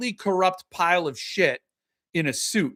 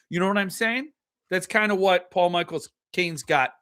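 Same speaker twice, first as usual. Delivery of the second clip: ordinary talk, nothing marked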